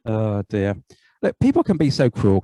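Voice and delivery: normal talking voice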